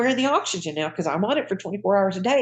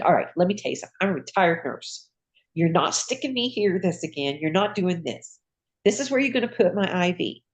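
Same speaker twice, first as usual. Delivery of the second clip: ordinary delivery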